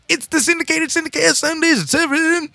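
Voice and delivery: squeaky high cartoon announcer; high silly voice. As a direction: push it way up into a goofy squeal. Radio promo with silly voices.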